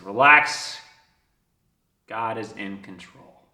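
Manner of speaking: measured, reassuring message after a long pause; calm revelation. Retold or imitated. Reads the sign, first take.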